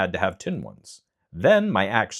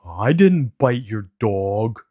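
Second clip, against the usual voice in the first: slow, loud, hurt lion voice; a big cat whining at the unfairness; complaining lion; 4 semitones higher, 7 dB louder, slower than usual. A narrator's reading. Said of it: "the lion complained".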